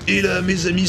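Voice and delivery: normal talking voice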